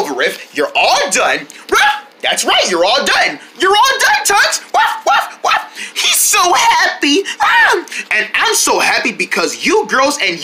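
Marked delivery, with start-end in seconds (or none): 4.7-5.7 high, yappy pretend barks; playful dog bark. Pretending to be the happy dog at the vet, high yappy barks.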